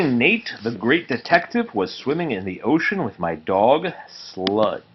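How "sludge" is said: ordinary delivery, nothing marked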